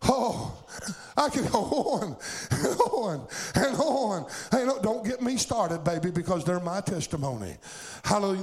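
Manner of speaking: fervent revival preacher in full cry; full-force preaching. Real, not acted. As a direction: preach it hard, driving each phrase to the congregation.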